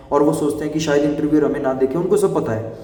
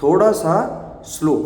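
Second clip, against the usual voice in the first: a bit slower; 4 semitones higher, slower than usual. Shown as a demonstration. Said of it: "लेकिन आपको".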